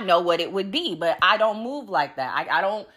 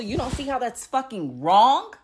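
ordinary talk

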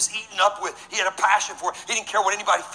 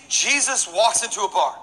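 normal talking voice

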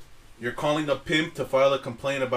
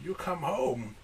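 put-on Chinese restaurant accent; 7 dB quieter, slower than usual. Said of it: "Why do you sound like the guy at the Chinese restaurant?".

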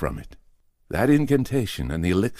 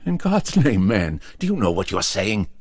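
much higher and louder, aghast; alarmed outburst; 5 semitones higher, 5 dB louder than usual. Acted. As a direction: burst out in horrified disbelief. Carson bursts out in alarm.

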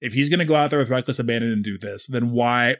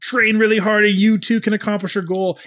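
mock shonen pep talk; 9 semitones higher, 3 dB louder than usual. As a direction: parody a cheesy anime hero's rallying cry. Mocking clichéd shonen message.

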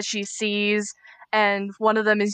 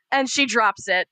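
ordinary talk, nothing marked

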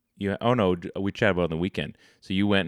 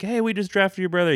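mock team voice; 13 semitones higher, 4 dB louder than usual. Mock quote of team to player.

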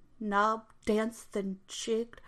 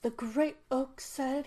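plain reading voice

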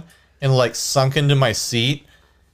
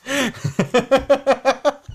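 high laugh; 12 semitones higher than usual. Real, not acted. Laughs at teasing about lips.

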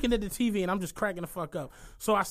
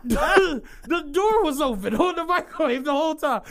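loud, high, laughing punchline; real laughter breaking into the words; cracking up; 9 semitones higher, 8 dB louder than usual. Real, not acted. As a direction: shout it through helpless laughter.

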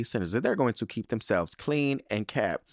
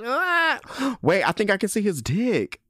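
higher, louder gasp and shriek; shocked squeal; 10 semitones higher, 5 dB louder than usual. Real, not acted. Host gasping at something in the photo. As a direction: gasp like you just spotted it.

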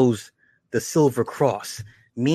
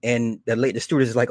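usual speaking voice

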